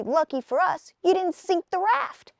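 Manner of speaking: a put-out little hero, voiced high; Woody's voice. A narrator's reading. Woody character voice.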